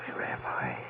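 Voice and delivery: whispered, lost and groggy; dazed whisper. Acted. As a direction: breathe it out half awake. Waking dazed in strange place.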